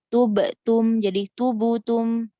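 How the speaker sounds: tubutum said slowly with an added u vowel; wrong tubutum. Shown as a demonstration. Teacher demonstrating the wrong tubutum. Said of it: "nggak boleh".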